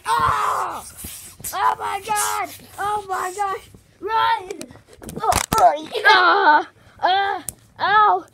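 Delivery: loud, high play-acted panic; panicked screaming. Characters running from sudden danger, loud panicked screaming. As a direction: scream and yell as if fleeing.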